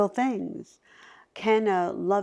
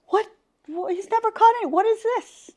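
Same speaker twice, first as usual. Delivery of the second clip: much higher and louder, fast, astonished father; stunned disbelief; 7 dB louder than usual. Retold or imitated. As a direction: blurt it out in shock at the squirrel. Father stunned by dead squirrel.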